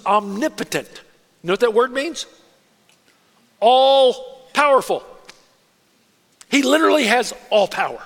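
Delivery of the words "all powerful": slow, loud stress on All powerful; emphatic. Acted. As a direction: stretch out All powerful, louder. Defining omnipotent to congregation.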